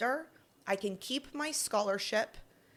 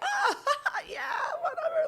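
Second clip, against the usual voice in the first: much higher, louder laughing brush-off; fake-casual laugh to hide the hurt; playing it cool; 4 dB louder than usual. Jill brushing off football guys' question, forced casual laugh. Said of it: "trying to play it cool".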